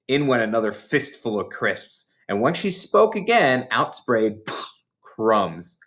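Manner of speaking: usual speaking voice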